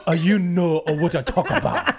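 hammed-up fake Chinese accent; mock Chinese accent. Co-host joking after refusing to make noise, mock Chinese accent. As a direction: put on a caricatured Chinese accent. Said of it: "me as Chinese guy".

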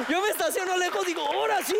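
high, laughing boast. Real, not acted. Joking about parking far away, laughing. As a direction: chuckle through the punchline. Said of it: "me siento orgulloso".

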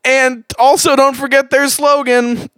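high-pitched, desperate ad read; frantic pleading. Pretend. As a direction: wail the promo like a hostage begging. Frantic begging to end the ad.

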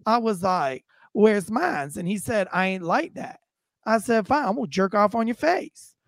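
theatrical storytelling with swooping pitch; nonchalant suspect. Retold or imitated. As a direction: imitate an unbothered man explaining himself to police. Plays suspect telling his side.

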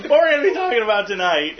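high giggly voice; laughing. Real, not acted. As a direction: giggle through the line. Accent game, giggling.

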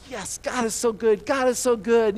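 high, loud mock rejoicing; exuberant praise. Freed Israelite praising God in the moment, exuberant. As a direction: celebrate as if freshly rescued. Acting out celebrating worshipper.

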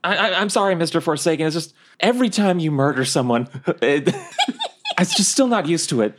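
high-pitched, stammering apology; apologetic sidekick. Acted. Timid Bucky apologises to Forsaken.

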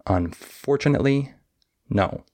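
high FOR in "Unfortunately", light "tunately", then "no"; stress on FOR and NO. Shown as a demonstration. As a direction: beat on FOR, quick weak syllables, beat on "no". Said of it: "So listen again".

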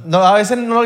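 ordinary talk, nothing marked

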